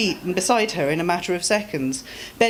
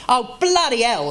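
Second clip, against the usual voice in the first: put-on slipping working-class accent; 7 semitones higher, 4 dB louder than usual. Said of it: "the accents of a youth spent far from gold-paved streets".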